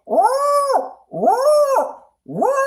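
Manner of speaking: very high woo woo cat calls; imitating a cat barking. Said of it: "when the cat's going".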